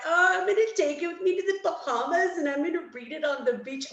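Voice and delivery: high, loud, airy self-parody; mock your own carefree plan in a lifted voice; self-mocking breeziness. Procrastinator mocking her own holiday study plan, breezy and high.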